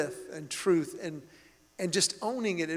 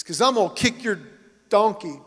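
mock threat; 2 semitones higher, 6 dB louder than usual. Angry driver threat retold.

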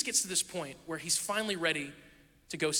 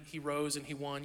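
ordinary talk

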